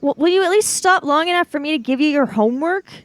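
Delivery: usual speaking voice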